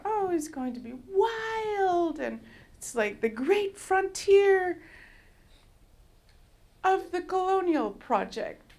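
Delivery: high, slow, starry-eyed; mock naive excitement. Mocking her own hopes of the wild frontier, high and slow. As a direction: play up the naive dream. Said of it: "because I thought".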